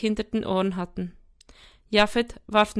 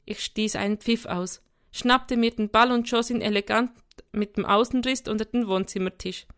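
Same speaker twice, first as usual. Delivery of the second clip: ordinary delivery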